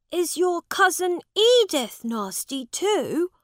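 put-on high young prince asking; a child probing the story; curious boy king. A narrator's reading. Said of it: "asked Edward".

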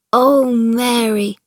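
slow, loud, longing Oh Mary; excited cry. Acted. Colin begging Mary about the garden, crying out loudly. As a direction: cry out her name in wonder. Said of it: "he cried out".

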